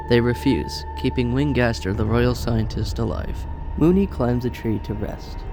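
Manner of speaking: usual speaking voice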